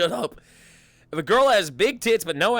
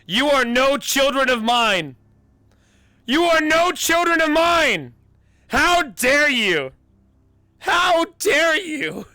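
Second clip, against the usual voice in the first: high, loud theatrical shout; drawn-out, booming outrage, pitched way up; outraged disowning; 11 semitones higher, 9 dB louder, slower than usual. Acted. Disowning "children of mine", in an outraged high shout.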